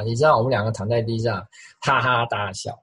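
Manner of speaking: ordinary talk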